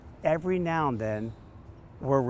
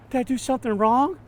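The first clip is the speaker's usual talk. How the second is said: worried client; 12 semitones higher, 7 dB louder than usual. Retold or imitated. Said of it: "with this big look on her face like".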